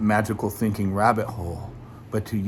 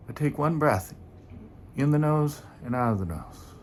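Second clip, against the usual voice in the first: guided meditation; 5 dB quieter than usual. Acted. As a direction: ease listeners gently into the breath. Leads a meditation.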